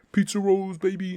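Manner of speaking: loud, high, triumphant announcement; excited reveal. Acted. Villain bribing hungry hero with snacks, excited. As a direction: burst out with it like a grand prize reveal.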